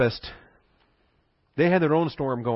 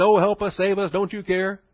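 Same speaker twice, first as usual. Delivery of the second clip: higher, louder mock plea for help; louder panicked mock wail; mock panicked cry; 6 semitones higher, 3 dB louder than usual. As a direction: ham up the frightened disciples crying out. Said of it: "crying and moaning".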